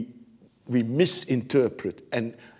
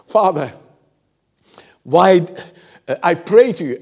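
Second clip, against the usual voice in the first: pleading call; 5 semitones higher, 9 dB louder than usual. Acted. Praying person voiced.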